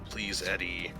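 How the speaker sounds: ordinary delivery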